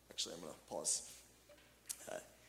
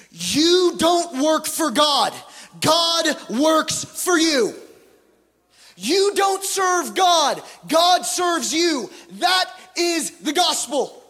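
slow emphatic proclamation; 6 semitones higher, slower than usual. Real, not acted. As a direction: hammer every phrase slowly to the crowd. Preacher proclaims key point.

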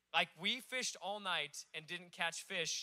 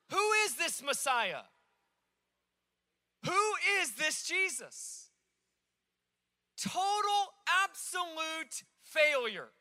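loud, high, impassioned preaching; preaching at full force; 7 semitones higher, 7 dB louder than usual. Real, not acted. Pastor pressing the congregation on the disciples' failure, impassioned. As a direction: drive it home from the pulpit, slow on key words.

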